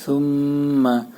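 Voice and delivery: সুম্মা with a held nasal ম, slowly; nasalized mim. Shown as a demonstration. Teacher demonstrating ghunna on সুম্মা. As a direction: hum through the ম in সুম্মা. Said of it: "মিমে গুণ্য করতে হবে".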